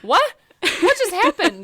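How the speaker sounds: high, loud baffled outburst; mock bewilderment. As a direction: play someone stunned by a ghost's gossip. Acting out the stunned living-room victim.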